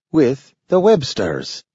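high, slow, careful declaration; a dog proudly naming his family; talking dog, declaring. A narrator's reading. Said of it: "declared Nathaniel".

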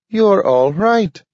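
a small eager dog, voiced high and bright-eyed; Nathaniel the dog. A narrator's reading. Dog Nathaniel relieved to find Grant awake, high dog voice. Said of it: "said Nathaniel".

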